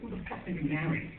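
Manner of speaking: muttered aside. Retold or imitated. Husband muttering about his wife, fast under-the-breath mutter. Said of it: "And I'm like".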